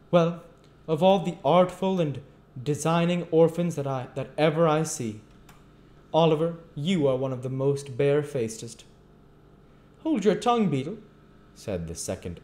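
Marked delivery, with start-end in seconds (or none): 0.1-8.8 loud, high, pompous outrage; impressively solemn. A narrator's reading. Beadle denouncing an artful orphan, loudly solemn. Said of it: "with most impressive solemnite". 10.0-11.0 high, loud order to be silent; sharp rebuke. A narrator's reading. Magistrate silencing the beadle, sharp and loud.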